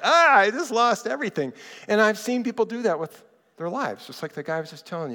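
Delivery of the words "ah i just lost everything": high, loud imitated wail; mock weeping. Preacher voicing someone whose house is destroyed, mock weeping. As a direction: wail it out, acting someone weeping over losses. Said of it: "just weeping".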